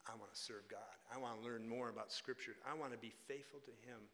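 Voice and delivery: low, very quiet retold wish; soft sincerity. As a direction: gently voice a girl's earnest wish. Voices the granddaughter's sincere wish.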